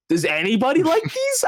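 high-pitched mock outrage; retold indignation. Retelling a complaint to the diner waitress, indignant. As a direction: re-enact your exasperation, pitch right up. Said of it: "I was so mad".

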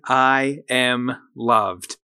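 slow, deliberate, every word weighted; slow affirmation. Shown as a demonstration. Host leading listeners in an affirmation, slow and deliberate. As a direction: lay it down slowly so they can repeat it. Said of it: "So say that with me".

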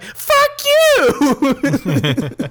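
high, loud comic outburst; mock outraged yell. Customer handed a magnifying glass, mock outraged yell. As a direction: yell it out in hammed-up indignation.

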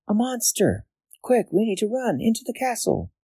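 high, urgent princess voice; cartoon princess. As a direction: sound like a frightened cartoon girl. Princess character voice.

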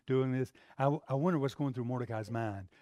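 usual speaking voice

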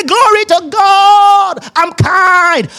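very high, drawn-out praise cry; exaggerated victorious declaration; jubilant shout. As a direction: proclaim it in mock triumph, stretched and ringing. Acted triumphant self-declaration.

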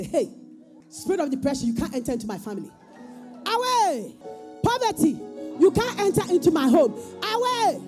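high shouted rebuke; booming motivational speech. Pretend. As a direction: command the spirits away. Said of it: "you have the right to stand up and say".

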